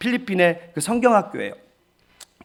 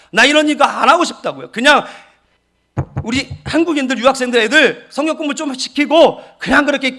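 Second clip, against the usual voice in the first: loud, raw protest, voice pushed hard; arguing with God; 3 semitones higher, 5 dB louder than usual. Retold or imitated. Pastor retelling how he argued with God backstage, loud protest. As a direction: shout your complaint straight at God. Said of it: "막 따졌어요".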